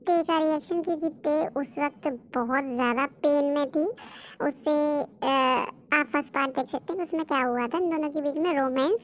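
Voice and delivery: walk the listeners through the plot calmly; story narration. A narrator's reading. Narrator explains the flashback. (कहानी सुनाती हुई धीमी, नीची आवाज़)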